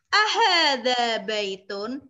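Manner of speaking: hadza maktabun, each vowel drawn out; slow, loud teacher's model of hadza maktabun; slow vowelled reading. Shown as a demonstration. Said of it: "sambil diharokati". (hadza maktabun dibaca pelan dengan harakat)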